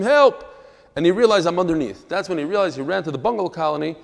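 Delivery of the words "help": higher, louder mock yell; retold scream for help. Boy in the cesspool calls to his friend, retold scream. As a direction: call out a retold cry for help. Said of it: "and I scream".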